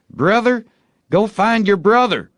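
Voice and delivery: high, loud calling out; boy's charm call. A narrator's reading. Tom tosses a marble to find its brother, high call. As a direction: urge the marble like a spell.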